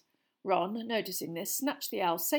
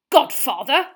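loud, higher, outraged question; spluttering; 6 semitones higher, 15 dB louder, slower than usual. A narrator's reading. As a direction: splutter it in disbelief. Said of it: "spluttered Uncle Vernon".

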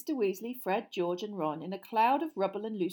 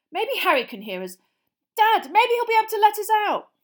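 loud, high, frantic calls; trapped wizards squabbling; 12 semitones higher, 15 dB louder than usual. Acted. Family stuck behind the boarded fireplace, frantic shouting. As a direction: jostle and yell in a tight space.

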